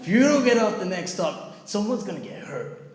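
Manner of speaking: loud, high outburst; mock-angry warning, starting at a yell; imitated threat. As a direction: imitate a heckler threatening passengers. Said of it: "like this".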